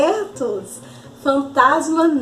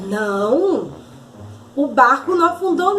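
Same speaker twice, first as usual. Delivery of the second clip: usual speaking voice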